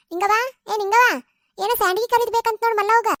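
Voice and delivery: loud, high call to a neighbour, a bit fast. Acted. Granny calling out to neighbour Ningavva. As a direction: shout her name across the lane.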